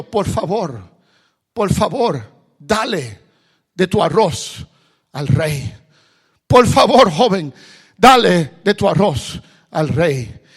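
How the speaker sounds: loud pleading, rising to a shout; the king begs loudly for rice. Acted. King loudly begging the beggar for rice, pleading shout.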